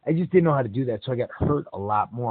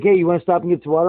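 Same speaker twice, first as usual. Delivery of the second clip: loud call; 6 semitones higher, 9 dB louder, faster than usual. Retold or imitated. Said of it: "He's like".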